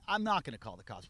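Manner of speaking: plain reading voice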